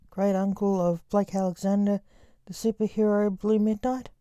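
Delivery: ordinary delivery, nothing marked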